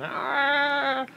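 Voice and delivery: high mock scream, soap in the eye; imitated kid scream. Kid in the tub with soapy eyes, high mock scream. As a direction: yelp like a child with soap in his eye. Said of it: "the kid's screaming".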